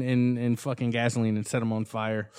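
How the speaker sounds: normal talking voice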